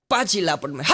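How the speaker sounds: ordinary delivery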